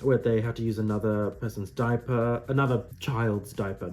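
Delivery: normal talking voice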